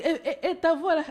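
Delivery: high, laughing astonished sister; surprised sister. Retold or imitated. Sister hears her healed voice, high astonished laugh. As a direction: play the amazed big sister. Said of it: "elle dit".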